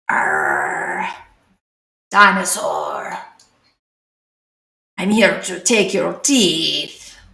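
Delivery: slow, lightly put-on pirate growl; a not-very-good pirate accent, drawn out slowly. Orange pirate dinosaur come for your teeth, mock pirate accent. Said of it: "I don't think that was a good pirate accent".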